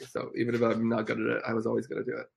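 ordinary delivery

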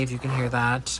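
normal talking voice

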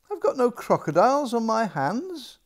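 put-on high, loud child's protest; a little girl insisting she's right; indignant princess. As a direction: princess arguing with the maid. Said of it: "princess answers the maid".

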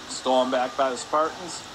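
ordinary delivery

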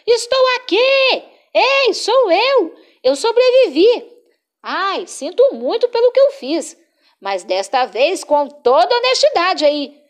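high, loud calling for help. Acted. Cabeçudo calling friends from a broken branch, high and loud. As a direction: shout from the branch to be found.

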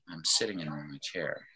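low, very soft, listless; apathetic. Shown as a demonstration. Teacher contrasting two emotions for the class, apathetic. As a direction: let all the energy drop out, barely caring. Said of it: "might be feeling apathetic".

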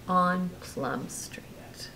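soft sleepy whisper. A narrator's reading. Parent whispering the ending to Brooklyn. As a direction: whisper so you don't wake him.

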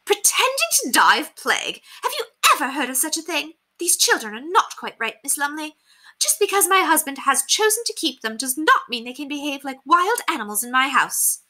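high, haughty indignation; scowling lady. A narrator's reading. Lady complaining to governess about children, scowling. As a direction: scold grandly in a high, affected voice.

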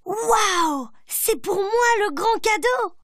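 high, thrilled little bear; child amazed by a big present; excited child. A narrator's reading. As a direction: gasp with delight at the gift. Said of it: "Petit ours brun dit".

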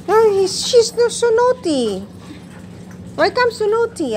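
mock-stern pet talk; playful scolding. Real, not acted.

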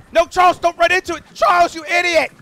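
high, loud exasperated shout at the horse; frustrated yell. Real, not acted. Player yelling at his horse for spooking the target, angry shout. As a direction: scream at him like he just ruined everything.

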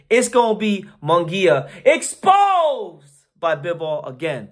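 big drawn-out announcer-like cry on EXPOSED; emphatic proclamation. Real, not acted. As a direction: call it out, drawing out "exposed". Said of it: "I'm gonna say it like this".

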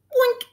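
high, loud comic boink; bright squeaky bonk noise; cartoon boink. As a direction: pop it out like a cartoon bump. Character falls down, sound effect.

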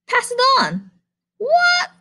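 very high puzzled squeak; squeaky goose. Acted. Goose hearing pig's message, very high confused squeak.

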